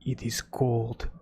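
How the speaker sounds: put-on deliberate, blunt Russian accent; mock Russian accent. Russian advising ice cream against the cold, in a mock Russian accent. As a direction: play a matter-of-fact Russian, slowly. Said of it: "the most Russian thing".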